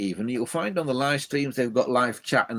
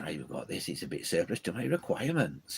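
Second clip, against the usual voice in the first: soft, amateur Welsh impression; bad Welsh accent; 4 semitones lower, 7 dB quieter than usual. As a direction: quietly imitate a Welsh speaker, not quite convincingly. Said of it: "Sorry about the bad impression".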